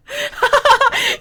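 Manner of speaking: high, loud cackling laugh; shrieking laugh; burst of laughter. Real, not acted. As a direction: crack up loudly.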